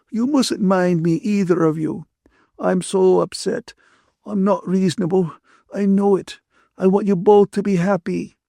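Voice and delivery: high-pitched, tearful and upset; sobbing apology. A narrator's reading. Ferguson begs both friends not to mind her, sobbing. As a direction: apologise through acted tears. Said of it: "Ferguson sobbed".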